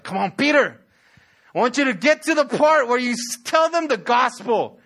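lightly acted impatient prodding; impatient urging. Impatient Holy Spirit urging Peter on.

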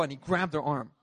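ordinary delivery

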